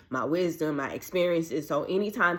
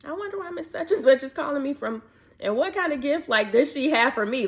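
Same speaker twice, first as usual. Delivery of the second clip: much higher, quick puzzled musing; puzzled wondering; 11 semitones higher, 3 dB louder than usual. Retold or imitated. Replaying her own puzzlement over the voicemail, high and baffled. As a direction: wonder aloud, baffled and curious.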